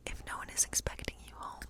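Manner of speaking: normal talking voice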